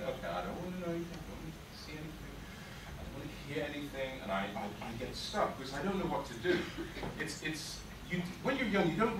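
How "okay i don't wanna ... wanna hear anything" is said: soft, rapid muttered refrain; hushed inner thought. Acted. Bullied boy to himself, not wanting to hear, hushed. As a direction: shut it all out under your breath.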